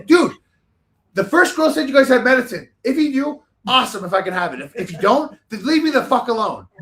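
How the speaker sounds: loud, high exasperated outburst; agitated as hell. Retold or imitated. Sick passenger telling off the cabin manager, loud and agitated. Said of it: "agitated as hell now".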